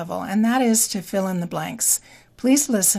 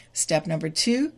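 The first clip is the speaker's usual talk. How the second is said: ordinary talk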